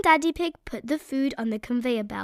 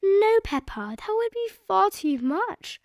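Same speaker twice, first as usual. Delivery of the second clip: higher gentle mother voice; Mummy Pig; 8 semitones higher than usual. A narrator's reading. Mummy Pig telling Peppa no, gentle. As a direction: kindly refuse a child. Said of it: "replied Mummy Pig".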